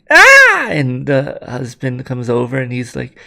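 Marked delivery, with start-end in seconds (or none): 0.1-0.7 loud, high-pitched scream; sudden piercing acted shriek. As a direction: scream out high at full volume. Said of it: "she starts to scream".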